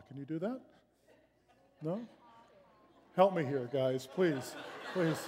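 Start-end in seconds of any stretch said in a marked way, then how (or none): none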